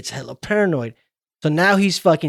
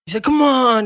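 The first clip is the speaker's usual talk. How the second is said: mock taunt; 8 semitones higher, 3 dB louder than usual. Villain taunting with a knife.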